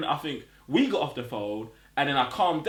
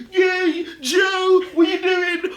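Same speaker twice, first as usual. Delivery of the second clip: very high, loud excited yelling; mock hysterical friend on the phone; screaming hype; 9 dB louder, much slower than usual. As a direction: shriek it like you just heard huge news.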